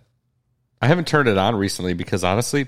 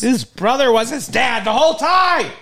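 mock sobbing rant; 13 semitones higher, 3 dB louder than usual. Mock dad sobbing to kids.

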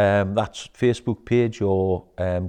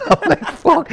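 high, louder burst of laughter; laughing; 7 dB louder than usual. Real, not acted. Coach laughing as he recalls a session's impact. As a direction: laugh out the amazement.

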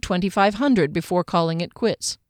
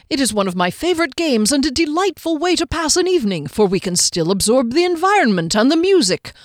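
higher, cheerful little gentleman; chatty halfling; 4 semitones higher than usual. Acted. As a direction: prattle on like a polite halfling. Hector's long chatty speech.

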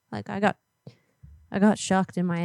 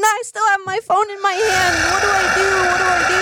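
high, wailing mock panic; scared; 9 semitones higher, 3 dB louder than usual. Caller pleads with Alvin during abduction, hammed-up panic. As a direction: beg to be saved in a hammed-up frenzy. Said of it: "I'm scared".

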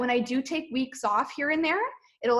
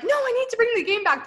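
much higher, lightly louder insistence; eager declaration; 11 semitones higher, 4 dB louder, faster than usual. Retold or imitated. Telling her husband and parents her plan, eagerly. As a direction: announce it like a sudden resolve.

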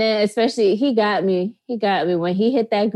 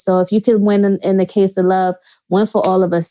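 ordinary talk

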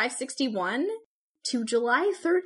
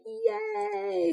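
excited yay; 10 semitones higher than usual. Retold or imitated. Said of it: "I was like".

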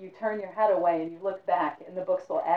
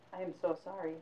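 soft apology; 8 dB quieter than usual. Acted. Apology repeated.

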